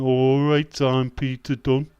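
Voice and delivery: loud Birmingham drawl; hammed-up Brummie impression; Brummie accent. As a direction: shout a mock Brummie greeting. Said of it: "Uh, Birmingham".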